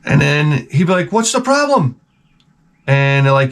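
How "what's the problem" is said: high, put-on Italian accent. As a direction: imitate an Italian manager in a high voice. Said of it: "he was Italian".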